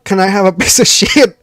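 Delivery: loud, amused re-enactment of an innocent request; retold request. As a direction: repeat the botched request loudly for comic effect. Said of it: "I'm like".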